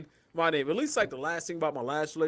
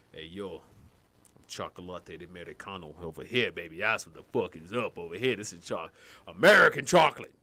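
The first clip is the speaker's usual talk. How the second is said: mock smooth pickup line; 5 semitones lower, 4 dB louder than usual. Imagined pickup line in Colombia.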